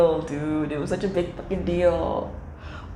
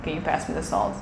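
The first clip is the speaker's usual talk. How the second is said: indifferent shrug; 4 semitones lower, 5 dB quieter than usual. Retold or imitated. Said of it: "people are like".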